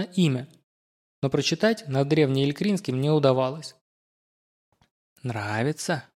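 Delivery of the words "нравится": low, soft, insinuating rasp; a wet hoarse gurgle, coaxing; wheezing gurgling voice. Acted. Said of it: "вкрадчиво спросил его хриплый, булькающий голос".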